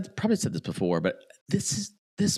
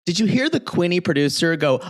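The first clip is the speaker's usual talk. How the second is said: ordinary delivery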